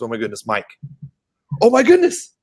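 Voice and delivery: high, gushing fan squeal; excited security guard meeting a famous YouTuber; starstruck fan. Retold or imitated. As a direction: gush like a starstruck fan meeting his idol. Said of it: "security guard looks at this guy and goes".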